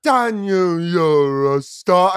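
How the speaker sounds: slow melodramatic praise; mock biopic line. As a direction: drag it out like a cheesy film. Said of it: "you're like".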